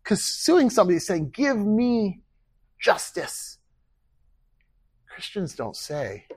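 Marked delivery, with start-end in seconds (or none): 1.3-3.6 raised, emphatic demand; mock litigant's demand. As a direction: press the demand, word by word. Acting out a person suing.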